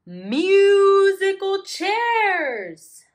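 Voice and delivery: very high, very slow pleading whine; pleading bears. Acted. Bears begging to play musical chairs, slow pleading voice. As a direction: beg for it sweetly and slowly. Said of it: "pleaded the bears".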